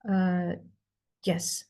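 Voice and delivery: normal talking voice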